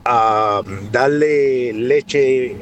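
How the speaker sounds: usual speaking voice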